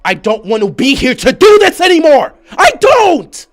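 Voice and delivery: high, furious fan meltdown; real screaming rant. Real, not acted. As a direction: yell it out, building to a scream. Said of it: "someone that screams".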